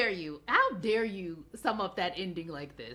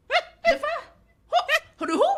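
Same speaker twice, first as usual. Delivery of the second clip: much higher, louder, alarmed yelps; high, loud hammed-up panic from Will; startled Will; 8 dB louder than usual. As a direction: blurt it out in shock. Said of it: "He said".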